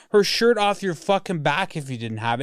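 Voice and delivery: usual speaking voice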